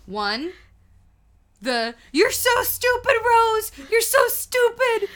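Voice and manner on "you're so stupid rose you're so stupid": very high and loud, tearful line; Titanic hero. Retold or imitated. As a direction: cry it out like the hero scolding Rose. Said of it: "there's two things that make me sob".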